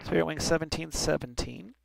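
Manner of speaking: ordinary talk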